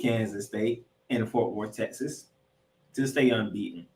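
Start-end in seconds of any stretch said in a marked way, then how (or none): none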